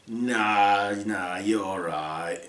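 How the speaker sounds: low, slow awkward brush-off; polite refusal. Acted. Prince awkwardly declining, low and slow.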